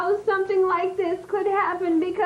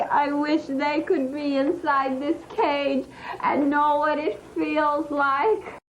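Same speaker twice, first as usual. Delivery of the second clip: ordinary talk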